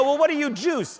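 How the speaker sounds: incredulous question. Retold or imitated. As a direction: voice jumping up in disbelief. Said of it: "And I go".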